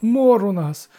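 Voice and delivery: high, loud wail of woe; desperate high-pitched complaint. A narrator's reading. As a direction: lament it pleadingly to a god. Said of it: "заголосив староста".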